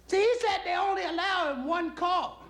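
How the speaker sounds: very high falsetto wife; comic housewife voice, way up high; wife relaying message. Acted. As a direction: pipe the message down the phone in falsetto. Wife relays the caller's plea.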